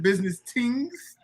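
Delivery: slow Jamaican accent, voice rising at the end. Shown as a demonstration. Host checks how to say the channel name, Jamaican accent. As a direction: draw it out as a Jamaican question. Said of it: "say it with a Jamaican accent".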